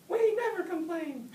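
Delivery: very high, loud little chorus; squeaky sibling voices chiming in; snail siblings. A narrator's reading. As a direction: siblings backing up their mother.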